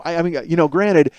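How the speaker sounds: ordinary delivery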